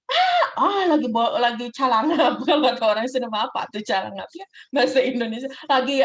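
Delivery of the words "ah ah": two sharp, very high, loud gasps; mock choking gasps of a sudden death; dying gasp. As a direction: gasp as if the breath is cut off.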